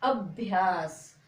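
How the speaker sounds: अभ्यास drawn out very slowly, the half भ said quickly into या; अभ्यास modelled slowly and repeated for pupils; slow teaching demo. Shown as a demonstration. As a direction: sound out अभ्यास slowly for beginners. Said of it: "कैसे पढ़ोगे?".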